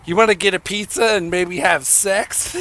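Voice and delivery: Adam. Retold or imitated. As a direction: pitch it as a cheeky joke come-on. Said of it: "he'd be like".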